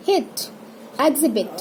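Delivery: brief i in हिट, एग्जिबिट; rapid short i. Shown as a demonstration. Teacher models the small i, हिट, एग्जिबिट said short. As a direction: keep the i quick in हिट and एग्जिबिट. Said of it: "इसको भी rapid pronounce करना है".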